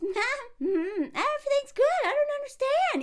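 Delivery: high, sugary put-on innocence. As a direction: play dumb in a sweet high voice.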